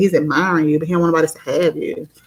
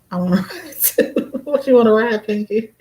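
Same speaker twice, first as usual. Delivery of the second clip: breaking into giggles while talking; amused, higher-pitched words through a laugh; tickled, laugh the question out; 5 semitones higher than usual. Real, not acted. Reads viewer's question and cracks up.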